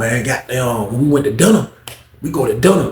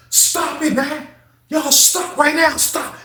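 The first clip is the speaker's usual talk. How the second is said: higher, softer, slow pleading; 14 semitones higher, 3 dB quieter, much slower than usual. Retold or imitated. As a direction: beg them to stop. Paul tries to stop the fight.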